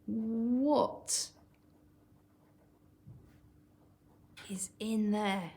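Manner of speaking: eager, keen question; excited. Shown as a demonstration. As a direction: voice Leon's impatience to know. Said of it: "I would describe him as being excited".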